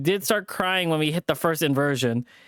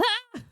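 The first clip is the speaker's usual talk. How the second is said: high scream. Acted. Coaster drop.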